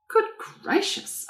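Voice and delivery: gasped in surprise, breathy and high; a startled little gasp; surprised exclamation. A narrator's reading. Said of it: "I exclaimed".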